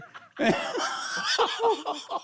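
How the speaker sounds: long high laugh. Real, not acted. Admitting the firecracker prank, a long high laugh. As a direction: keep laughing high and breathless.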